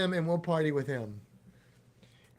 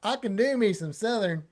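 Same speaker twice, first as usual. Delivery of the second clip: put-on Southern twang; boastful demo of a Southern accent; 4 semitones higher, 4 dB louder than usual. Shown as a demonstration. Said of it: "I can do a good Southern accent".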